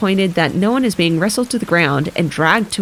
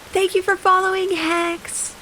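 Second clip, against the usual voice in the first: gasp, then a high, breathy-free lady's voice; high posh old lady; 11 semitones higher than usual. Acted. Elizabeth's high gasping character voice.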